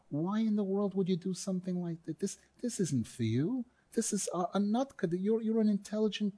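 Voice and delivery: put-on soft, reassuring appeal to a child; gentle encouragement. Parent to a son who did wrong, gentle encouragement. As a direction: talk a kid round, softly and kindly. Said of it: "What's really needed here is to be able to say".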